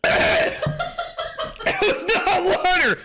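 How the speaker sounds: high, slow, laughing it out; cracking up in disbelief. Real, not acted. Streamer to chat about the drink, laughing.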